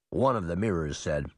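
ordinary delivery

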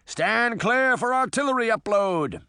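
high, carrying captain's order. A narrator's reading. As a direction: call out above the noise of battle. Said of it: "Sinbad's voice rose above the noise".

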